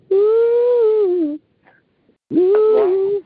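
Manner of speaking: high, loud happy whoop; joyful whoop. Real, not acted. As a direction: whoop it out to greet the morning. Cheering on the morning call.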